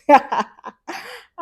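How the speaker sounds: high loud laugh; burst of laughter. Real, not acted. Laughing about African moms, loud and high.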